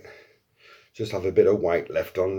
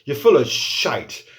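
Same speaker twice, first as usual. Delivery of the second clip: mocking crowd; 8 dB louder than usual. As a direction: start dismissive, end in a loud jeer. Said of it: "people are saying to me".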